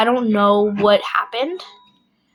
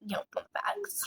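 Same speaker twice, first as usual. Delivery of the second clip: whispered; 4 semitones higher, 18 dB quieter than usual. Real, not acted. Trails into whisper.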